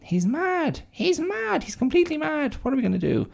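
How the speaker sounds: high, frantic crowd voice; high-pitched mock panic; panicked townsfolk. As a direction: squeal it like scared villagers. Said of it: "everyone's like".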